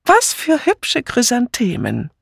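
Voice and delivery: high, slow admiring exclamation; drawn-out high exclamation; exclaiming. A narrator's reading. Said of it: "Schließlich rief sie".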